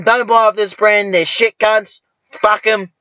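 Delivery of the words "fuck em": ordinary delivery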